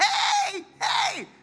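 high, loud calling hey; God calling to get attention; shouted divine summons, pitched high. Pretend. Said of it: "ruft er zu und sagt".

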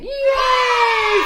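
put-on high cheering yay like kids; loud little kids cheering. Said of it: "the little kids saying".

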